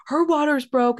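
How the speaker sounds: high-pitched mock panic; retold panic. Partner telling mom and aunt the news, panicked. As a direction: imitate a frantic partner breaking news. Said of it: "He's freaking out".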